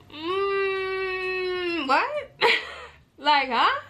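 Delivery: high, baffled mm what; stunned what. Retold or imitated. Girl reacting to boyfriend's break text with shock.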